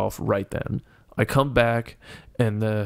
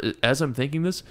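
normal talking voice

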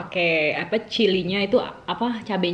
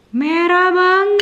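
amazed; 11 semitones higher, 9 dB louder, much slower than usual. Real, not acted. Amazed at red spicy soup.

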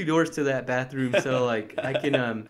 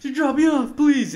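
high, louder desperate begging; desperate pleading; 11 semitones higher, 6 dB louder than usual. Retold or imitated. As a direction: whine it like you can't hold it. Desperate for a bathroom.

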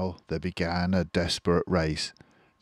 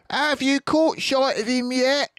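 much higher farmer call; Bean calling back; 4 dB louder than usual. A narrator's reading. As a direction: shout across the noisy field. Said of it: "Bean called back".

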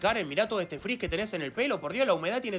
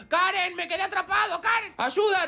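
loud, much higher cries; desperate calling; 9 semitones higher, 4 dB louder than usual. Acted. Trapped cat calling to Karen for help, loud and high.

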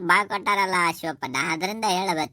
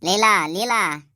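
louder, slow, drawn-out restraining call; calling to stop; 3 semitones higher, 5 dB louder, slower than usual. Acted. Husband calling wife Leela to calm down, louder slow call. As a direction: hold her back with her name.

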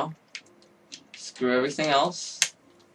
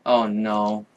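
normal talking voice

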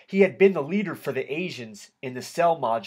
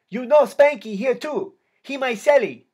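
put-on high, loud Vietnamese-accented call; 10 semitones higher, 7 dB louder than usual. A narrator's reading. K nine telling his friend about his cellmate, Vietnamese accent, loud. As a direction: shout it over in a Vietnamese accent. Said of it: "in a heavy Vietnamese accent".

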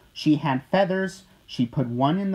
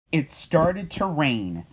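ordinary delivery, nothing marked

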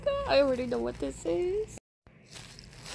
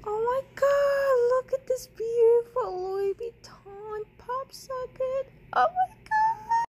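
excited; 7 semitones higher, 8 dB louder than usual. Real, not acted. Gushing over pop socket.

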